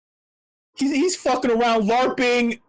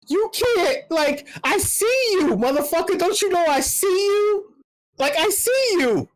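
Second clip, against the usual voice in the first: pitched way up, calling him out hard; higher and higher, jabbing at the liar; heated call-out; 9 semitones higher than usual. Real, not acted. Commentator calling out the CEO, pitched up and heated.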